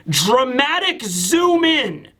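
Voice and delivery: slow, drawn-out announcement; mock-dramatic narration. Shown as a demonstration. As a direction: stretch ZOOM out for dramatic effect. Narrating a dramatic panel zoom.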